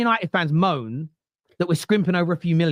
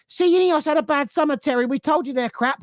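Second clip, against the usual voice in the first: higher, smug know-it-all fan; higher mock fan jeer; 7 semitones higher than usual. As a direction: mimic a gloating fan who was right all along. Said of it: "and you're gonna go".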